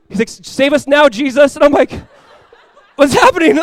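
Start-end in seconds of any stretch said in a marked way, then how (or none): 0.3-1.6 high, loud desperate plea; retold prayer, cried out urgently; praying out loud. Pastor crying to Jesus in a storm, praying out loud. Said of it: "starts talking out loud". 2.9-3.6 high, loud panicked question; hammed-up panic with a laugh in it; freaked out. Reacting to the pastor's prayer, retold freaked-out cry. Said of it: "I was so freaked out".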